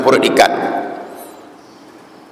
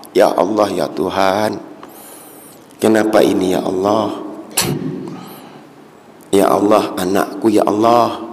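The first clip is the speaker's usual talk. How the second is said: pleading prayer; 5 semitones lower, 4 dB quieter than usual. Acted. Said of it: "mengadunya hanya kepada Allah".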